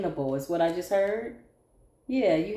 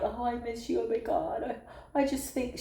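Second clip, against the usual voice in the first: soft, breathy-sounding mock longing; mock pining ex; 6 dB quieter than usual. Reader mimics the married ex softly pining. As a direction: imitate a pining ex, soft and needy.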